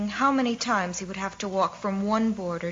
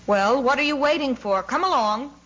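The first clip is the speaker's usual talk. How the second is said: higher, louder hurrying order; raised-voice, exasperated command; impatient shout; 5 semitones higher, 4 dB louder than usual. A narrator's reading. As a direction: chivvy the stretcher-bearers along. Someone orders the stretcher-bearers on.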